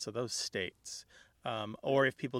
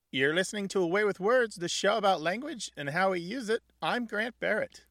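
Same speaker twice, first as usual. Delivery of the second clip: bright upbeat station ID; radio host announcement; 8 semitones higher, 5 dB louder than usual. Acted. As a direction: announce the show with energy. Show announcement.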